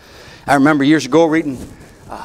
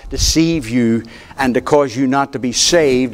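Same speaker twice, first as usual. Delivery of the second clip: normal talking voice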